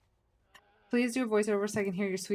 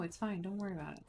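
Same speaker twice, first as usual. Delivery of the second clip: calm the scared cat gently; soothing; 2 semitones lower, 11 dB quieter, faster than usual. Real, not acted.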